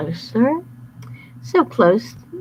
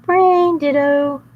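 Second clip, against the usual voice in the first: high loud goofy voice; dim wolf; 8 semitones higher, 5 dB louder, much slower than usual. Acted. Bad boy agreeing with partner, high goofy. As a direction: blurt it out dopey.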